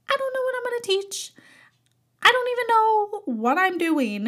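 panicked confession. Retold or imitated. Retelling panicked confession to boss.

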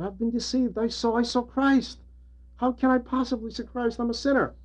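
high, pleading confession of a troubled monk; anxious young monk. Retold or imitated. Monk pleading with his elder, high and anxious. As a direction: cry out as if fearing delusion. Saint Silouan voiced, anxious.

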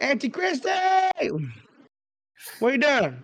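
high-pitched playful holler; silly calling out. Acted. Greeting Auntie Christy for laughs, silly high call. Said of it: "when I'm gonna be silly".

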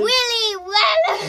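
loud, slow attempt at an Australian accent; poor Australian accent. Child defending the attempt, badly faked Australian accent. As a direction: draw it out in mock Australian. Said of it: "do an Australian accent poorly".

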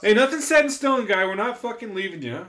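loud reassurance. Real, not acted. Friend insisting the soon-to-be dad is still included, loud and high. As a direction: burst in to reassure your buddy.

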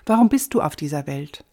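ordinary talk

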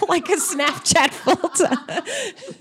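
said through laughter, slowed; laughing. Real, not acted. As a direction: laugh while getting the words out. Laughing at own joke.

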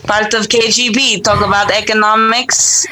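put-on Russian accent, a bit thick. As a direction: speak in a light mock Russian accent. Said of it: "should I go Russian accent?".

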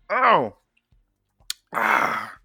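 ordinary talk

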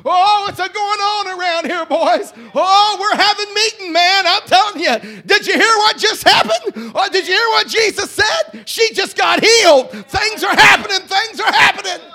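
shouted, high, loud preacher's whoop; full-force preaching. Acted. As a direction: preach it to the rafters, rising in joy.

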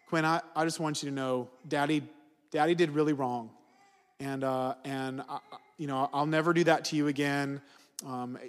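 put-on soft, gentle apology to a young daughter; tender apology. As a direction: say sorry quietly to your child. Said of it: "and I said".

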